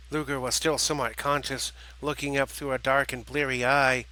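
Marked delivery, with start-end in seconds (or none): none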